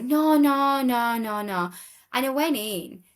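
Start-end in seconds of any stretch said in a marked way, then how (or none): 0.0-1.7 flustered no. Retold or imitated. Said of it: "he's like".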